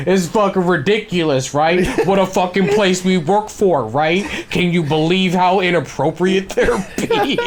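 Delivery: high, loud faux-indignant whisper-to-a-friend tone; gleeful fake outrage; mock outrage. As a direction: feign shock while loving the drama. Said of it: "He's like".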